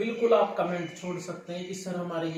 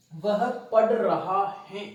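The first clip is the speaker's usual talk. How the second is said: slow translation; 5 dB louder, slower than usual. Shown as a demonstration. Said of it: "आगे देखो".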